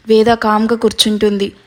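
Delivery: plain reading voice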